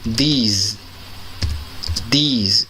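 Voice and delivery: These with a long ee and buzzing z; model pronunciation. Shown as a demonstration. Teacher drilling These for class, long vowel. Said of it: "E esse aqui".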